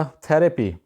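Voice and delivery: "تھراپی" as THE-ruh-pee; correct pronunciation. Shown as a demonstration. Teacher introduces the word therapy, correct pronunciation. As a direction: open "تھراپی" on short "e". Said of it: "اس کا صحیح گوروں والا تلفظ".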